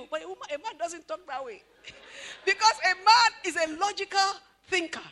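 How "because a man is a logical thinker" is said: ordinary delivery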